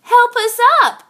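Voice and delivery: high, loud, quick plea for help; crying out for help. Acted. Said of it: "cried A".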